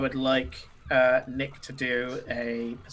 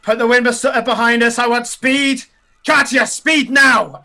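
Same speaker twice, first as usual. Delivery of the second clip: loud, higher barked orders; commanding shout; 8 semitones higher, 11 dB louder than usual. Acted. Sandera shouting orders to the crew for speed. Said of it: "and she goes".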